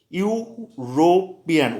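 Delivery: European stretched slowly, starting on a clear y sound; slow demo word. Shown as a demonstration. Teacher modelling the word for exam students, slow. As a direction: lay out European slowly for the class. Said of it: "इसका pronunciation भी vice होता है".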